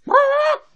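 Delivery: loud high bird squawk; chicken squawk. Retold or imitated. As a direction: squawk like a startled hen. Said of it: "She squawks".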